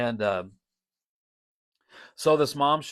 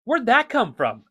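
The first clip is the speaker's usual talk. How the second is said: high, louder astonished asking; amazed question; 11 semitones higher, 8 dB louder than usual. Retold or imitated. Wondering aloud how the mom knew his words. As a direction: ask it in happy disbelief. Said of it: "it was just like".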